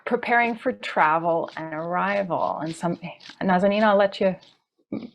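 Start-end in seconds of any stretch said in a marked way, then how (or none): none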